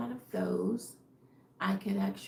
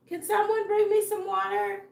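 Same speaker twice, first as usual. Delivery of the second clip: higher, louder call to the house; mock calling out; 10 semitones higher, 7 dB louder than usual. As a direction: playfully holler for someone's help. Calls out for water, alone at home.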